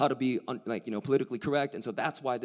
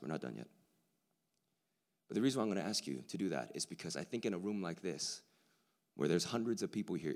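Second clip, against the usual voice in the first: normal talking voice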